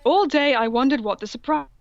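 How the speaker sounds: ordinary talk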